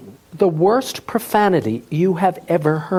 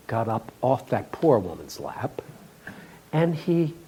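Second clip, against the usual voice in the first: usual speaking voice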